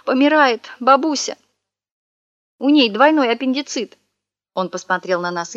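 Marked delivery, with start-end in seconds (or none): 0.1-4.0 high whiny mock sob; hammed-up wailing lament; fake sobbing. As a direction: whimper it like a con man faking grief. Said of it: "всхлипывать".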